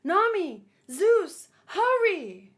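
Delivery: loud, slow urgent call; rally Zeus to hurry; urgent command. Acted. Nami calls on Zeus to hurry, loud and urgent. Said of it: "So she said".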